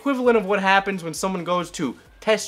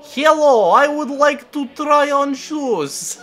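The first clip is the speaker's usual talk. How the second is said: slow, higher mock Russian accent, louder on hello; 10 semitones higher, 5 dB louder, slower than usual. Vlogger mocks priest in a Russian accent, slow and loud. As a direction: play a Russian shopper asking for shoes in a thick Russian accent. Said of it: "because that's how Russian people speak".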